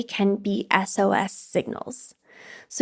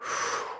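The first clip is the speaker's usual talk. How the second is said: long audible sigh; 11 dB quieter than usual. Shown as a demonstration. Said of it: "and then breathing".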